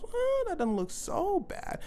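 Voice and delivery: very high, drawn-out reaction; mock reassured crowd. Crowd reacting to Michael's explanation, mock reassured. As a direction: draw it out high, like a won-over crowd.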